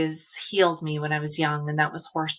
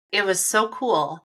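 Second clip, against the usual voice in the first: ordinary delivery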